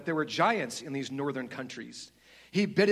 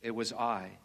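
slow, lower, solemn lion; lion's large deep voice; 4 semitones lower than usual. Acted. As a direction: reveal each deed gently and gravely. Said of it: "said the voice".

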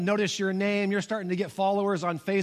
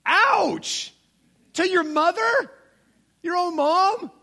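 incredulous ouch; 12 semitones higher, 6 dB louder, slower than usual. Real, not acted. Preacher's incredulous reaction.

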